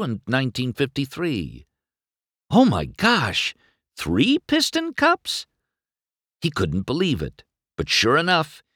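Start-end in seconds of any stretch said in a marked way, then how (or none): 2.5-5.5 slow, very high disbelief; high-pitched incredulous question; astonished. A narrator's reading. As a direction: draw it out, voice soaring in amazement. McQueen stunned by the trophies.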